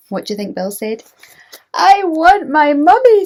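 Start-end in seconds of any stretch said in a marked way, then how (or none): 1.7-3.2 put-on high, loud babyish wail; tiny owl wail. Bill the baby owl crying for mummy, high and loud. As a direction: wail it big for the children. Bill wails, children invited.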